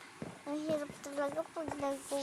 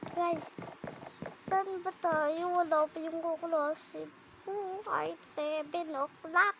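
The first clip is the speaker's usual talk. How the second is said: usual speaking voice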